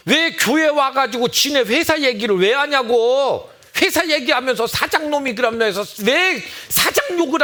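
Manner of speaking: exasperated pastor scolding the church. Acted. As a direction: rebuke them like a fed-up pastor. Scolding the congregation.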